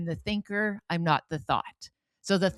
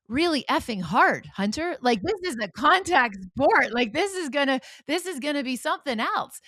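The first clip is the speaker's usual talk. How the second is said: exasperated warning; 8 semitones higher, 5 dB louder than usual. Retold or imitated. Mother retells warning to son.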